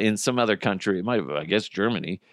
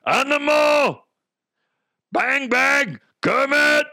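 high hollered puppet voice; Muppet-like voice; 13 semitones higher, 3 dB louder, slower than usual. Acted. Host likening his botched voice to Muppets, high holler. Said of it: "It's almost like".

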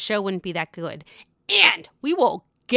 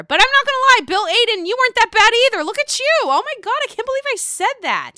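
high, louder giddy gushing; gushing flirt; 13 semitones higher, 6 dB louder, faster than usual. Real, not acted. Gushing to a hot husband, high giddy flirt. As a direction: swoon over a cast member, voice way up. Flirty aside to cast member on screen.

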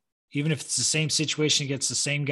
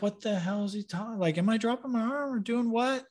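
high, softer retold bewilderment; mock-baffled self-questioning; confused; 7 semitones higher, 5 dB quieter than usual. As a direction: puzzle it over to yourself, pitched up. His confused reaction to coach.

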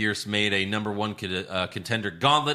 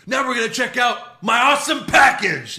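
much higher, louder excited shout; hype announcer shout; 13 semitones higher, 9 dB louder than usual. Retold or imitated. Retelling Austin Aries on commentary, loud hyped shout. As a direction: blast it like a hammy hype man. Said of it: "and he's like".

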